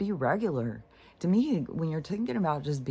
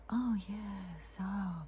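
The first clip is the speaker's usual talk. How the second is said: high, soft, airy whisper in imitation; mock serene whisper; 7 semitones higher, 8 dB quieter, much slower than usual. Mimicking a lady on TikTok who always talks so, mock serene whisper. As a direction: exaggerate the blissed-out hush. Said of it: "who really goes around talking like that?".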